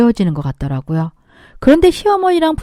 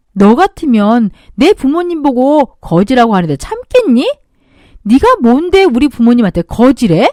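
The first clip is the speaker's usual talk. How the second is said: louder, hard scolding; angry rebuke; 5 dB louder than usual. Acted. Scolding sister-in-law for insulting parents, angry. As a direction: scold her, voice raised. Narrator drops honorifics in anger.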